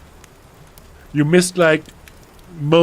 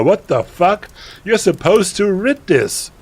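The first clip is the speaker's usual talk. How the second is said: ordinary delivery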